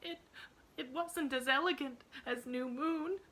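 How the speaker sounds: put-on high, tearful stammering child; sobbing girl. A narrator's reading. Emily defends her old home to her aunt, sobbing. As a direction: push the words out through crying. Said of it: "sobbed Emily".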